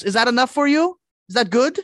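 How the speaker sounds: high, offended imitation of Udo Kier. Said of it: "he was offended that I asked him".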